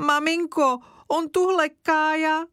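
put-on high whimpering child's voice; tearful complaint. Zdeňa complaining to mother about Kája, tearful. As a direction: whine to mother on the verge of tears. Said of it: "začala plačky Zdeňa".

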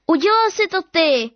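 put-on high, loud child's accusation; cried out. Girl accusing her brother in front of dad, loud and high. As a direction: fling the blame at your brother. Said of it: "vykřikla jsem".

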